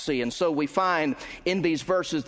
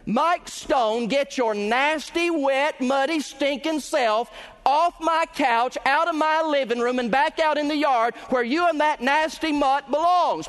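angry wife scolding; 9 semitones higher than usual. Acted. Wife scolding muddy husband and his dog off her couch, angry. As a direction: give him a furious tongue-lashing off the couch. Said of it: "if she does not say".

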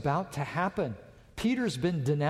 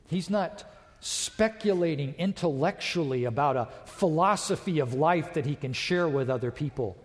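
normal talking voice

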